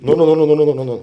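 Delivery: rapid loud string of no's; loud refusal. Retold or imitated. Aid worker refusing to be filmed, loud rapid no's. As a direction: fend off the camera with a burst of loud no's.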